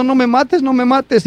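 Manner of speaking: high mock plea for mercy; mock pleading, not really scared. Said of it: "en vez de estar llorando o gritando".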